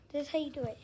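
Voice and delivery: ordinary talk, nothing marked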